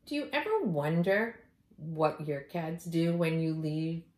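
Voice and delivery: ordinary delivery, nothing marked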